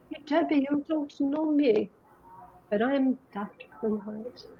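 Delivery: a hint of the German doctor's accent, drawn out. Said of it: "this guy with a German accent".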